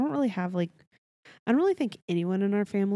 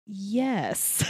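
mild, drawn-out compliance; chastened reply; 2 semitones higher than usual. Retold or imitated. Mom answering slow-talking cousin, chastened. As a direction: imitate sheepishly giving in. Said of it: "My mom was like".

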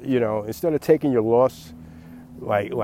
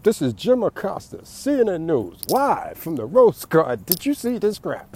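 higher reporter voice, live from the scene; Jim Acosta; 7 semitones higher, 3 dB louder than usual. Acted. CNN reporter on camera in the Rose Garden, hammed-up news voice. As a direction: play a TV reporter going live, half-shocked. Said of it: "television cameras pop up".

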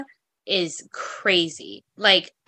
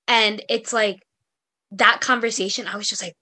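usual speaking voice

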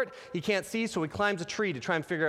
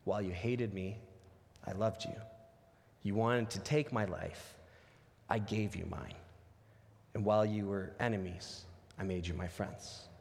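tender forgiveness; 9 semitones lower, 8 dB quieter than usual. Retold or imitated. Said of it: "He says".

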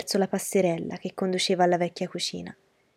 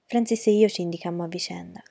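ordinary delivery, nothing marked

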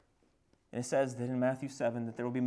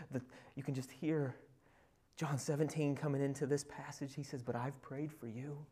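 soft preaching; 4 dB quieter than usual. Acted. Preacher speaking of intimacy with God, soft preaching. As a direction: draw them in close and hushed.

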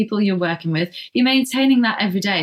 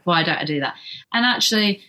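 usual speaking voice

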